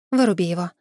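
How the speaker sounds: usual speaking voice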